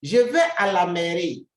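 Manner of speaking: loud, emphatic model sentence; model sentence called out. Shown as a demonstration. Teacher calling out the example to the class, loud and emphatic. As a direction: announce it to the class loudly. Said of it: "We say".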